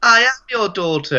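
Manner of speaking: put-on high little girl voice; man doing a woman's voice. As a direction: say it high like a spooky child. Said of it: "And she goes".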